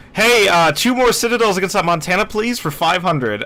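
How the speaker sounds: excited call-out. Retold or imitated. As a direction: call it out loud and keyed up. Calling out a request mid-battle.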